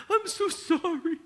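high, slow, tearful little voice; crying child. Retold or imitated. Daughter hugging dad while crying sorry, high and tearful. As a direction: sob out an apology like a small girl. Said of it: "she cried".

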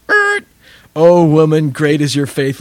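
lightly voiced Jesus, loud and lifted; a hint of Jesus praising her faith; a touch of proclaiming Jesus. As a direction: proclaim it warmly but with a hint of a public announcement. Jesus answers the woman.

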